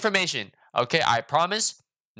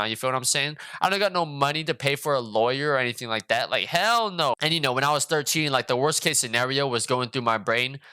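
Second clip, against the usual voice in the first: ordinary delivery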